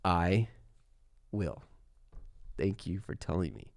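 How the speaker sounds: slow, soft and very deliberate; half-joking slow-motion reply; exaggeratedly slowed down. Shown as a demonstration. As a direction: answer the request by slowing right down. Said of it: "Um, slow down a bit".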